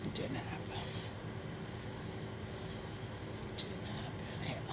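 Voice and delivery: very soft, nearly whispered admission; hushed punchline. Real, not acted. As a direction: drop to a whisper for the letdown. Deflated punchline after failed flight.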